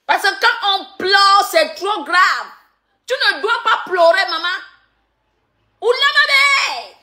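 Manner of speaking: mocking scold. Real, not acted. Mocking scold to crying rival. (gronderie moqueuse)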